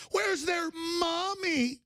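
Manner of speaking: very high, slow put-on whine; hammed-up sob-story voice; mock pitying wail. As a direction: stretch it out high, mocking their concern. Mocking the newspaper's pity for children.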